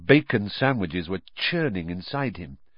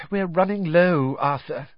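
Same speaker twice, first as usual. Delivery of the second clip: sighing; 6 semitones higher, 3 dB louder than usual. Acted. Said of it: "she sighed".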